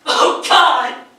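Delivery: high, loud cry to God; anguished shout. Acted. Widower losing his reason, crying out to God. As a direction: tear it out of the chest in despair.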